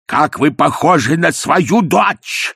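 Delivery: full-voiced shout. A narrator's reading. Ippolit shouting at his mother-in-law, full-voiced. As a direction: bellow it at full voice. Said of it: "закричал Ипполит Матвеевич полным голосом".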